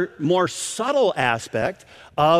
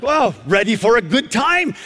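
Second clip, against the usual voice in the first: higher, taken-aback echo of her phrase; amazed reaction; 5 semitones higher than usual. Retold or imitated. Preacher reacting to the grandmother's question, amazed. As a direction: repeat it back, mildly astonished. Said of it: "I looked at her and".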